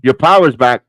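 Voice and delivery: loud call to the guest whose feed just came back. Real, not acted. Host calls out to guest Ethan, loud and pleased.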